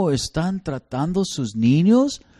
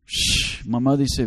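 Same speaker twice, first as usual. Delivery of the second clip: usual speaking voice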